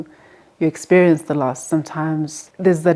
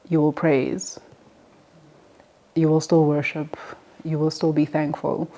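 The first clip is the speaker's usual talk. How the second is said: usual speaking voice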